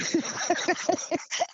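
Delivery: short voiceless chuckle; amused laugh in mid-story. Real, not acted. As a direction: laugh briefly to yourself. Chuckles while describing the crying man.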